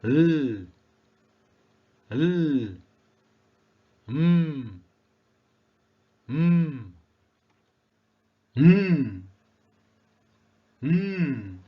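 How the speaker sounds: held consonants, "L" as "lll", "M" as "mmm"; drawn-out "L" and "M" sounds, rising then falling; phonics letter sounds. Shown as a demonstration. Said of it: "cara pengucapan atau pronounce alfabet".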